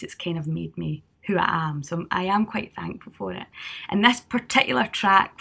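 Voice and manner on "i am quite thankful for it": ordinary delivery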